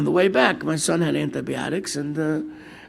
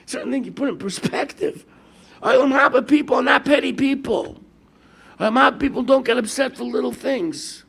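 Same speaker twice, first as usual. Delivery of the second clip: raised, louder preaching voice; emphatic preaching; 7 semitones higher, 7 dB louder than usual. Real, not acted. Preacher to congregation, raised emphatic voice. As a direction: drive the point home loud and high.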